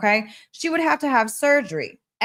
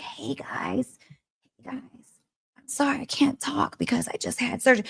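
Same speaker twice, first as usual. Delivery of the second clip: imitating a voiceless whisper; whispered as if the voice is lost after surgery; voice gone; 2 semitones higher, 5 dB quieter than usual. Friend greeting the meeting after throat surgery, voiceless whisper. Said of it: "So her voice was gone".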